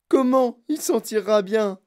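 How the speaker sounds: high, loud, indignant question; choking with outrage. A narrator's reading. Mother doubts her son will survive, choking with outrage. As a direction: throw it back, aghast. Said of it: "suffoqua Madame Gloop".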